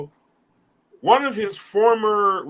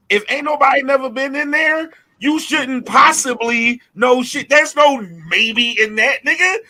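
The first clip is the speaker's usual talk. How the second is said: raised, higher, slowed insistence; heated rant; 7 semitones higher, 4 dB louder than usual. Real, not acted. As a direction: push it up high and loud, spelling it out.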